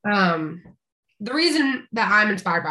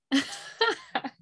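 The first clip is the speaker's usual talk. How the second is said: laugh; 7 semitones higher, 4 dB quieter than usual. Real, not acted. Laughs after naming [name].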